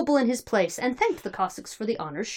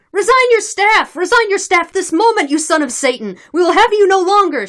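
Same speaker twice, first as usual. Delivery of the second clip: angry crowd shout; 11 semitones higher, 11 dB louder than usual. A narrator's reading. Cossacks demanding the chief resign, angry shouting. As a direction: shout it like a hostile mob. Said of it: "shouted some of the Cossacks".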